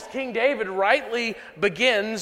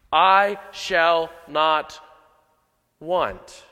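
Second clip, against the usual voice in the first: slow emphatic quote; 5 dB louder, much slower than usual. Shown as a demonstration. Preacher quotes the verse slowly.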